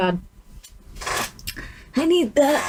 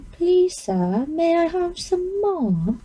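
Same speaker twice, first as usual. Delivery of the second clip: mock British beggar-boy plea, slow and higher; hammed-up British accent pleading for more food; awful British accent; 6 semitones higher, slower than usual. Girl doing makeup mocks herself with a bad British accent. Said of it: "Or my awful accent".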